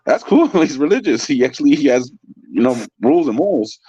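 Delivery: high-pitched, tickled delivery; laughing as you speak; amused, laughing. Real, not acted. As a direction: talk through bubbling laughter. Amused by own story.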